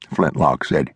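plain reading voice

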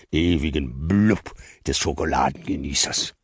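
slow, rapt savoring voice; dreamy Nikolaus. Acted. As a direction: linger over every word in bliss.

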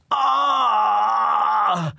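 long, high cry of pain. Acted. Narrator feeling his finger hurt, long pained cry. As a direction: draw out a high anguished "aah".

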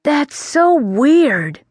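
winded and spooked; breathless. Acted. The prince leans on the oak, breathless and spooked. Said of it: "feeling breathless".

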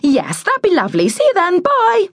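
false politeness climbing very high; breezy brush-off. A narrator's reading. Neighbour getting in first to end the visit, falsely cheery and high. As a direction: rise to a high sing-song goodbye.

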